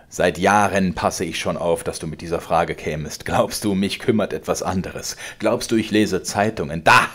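laut drohender alter Vater, höher und lauter; zorniger Vater. Acted. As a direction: schleudere ihm die Worte herrisch entgegen. Vater klagt an. (der Vater droht dem Sohn, laut und herrisch)

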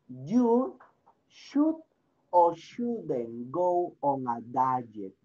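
teacher spelling out the choice; slow stressed choice. Shown as a demonstration. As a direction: lean hard on "should" and slow down for "or shouldn't go on a diet".

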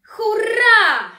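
huge, very high and loud cheer; child's hurray. Acted. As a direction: cheer like a delighted kid. Bartuś dostaje pistolet. (Bartuś wykrzykuje z radości)

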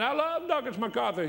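high-pitched imitation of an excited scream; mock scream. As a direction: imitate the doctor screaming his devotion. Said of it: "starts screaming".

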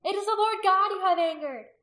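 high, loud rebuke; crying out. A narrator's reading. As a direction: cry the correction to the crowd. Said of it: "Rizpa cried out".

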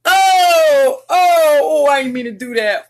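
high, loud embarrassed cry; embarrassed outcry. Real, not acted. Embarrassed after a slip.

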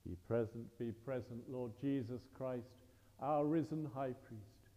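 ordinary talk, nothing marked

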